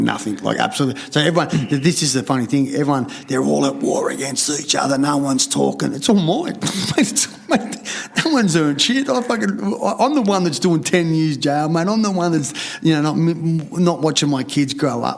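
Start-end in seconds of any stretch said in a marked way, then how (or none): 5.9-10.1 high, laughing mock greed; gloating laugh. Mimicking greedy family, laughing gloat. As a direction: cackle it like a greedy miser.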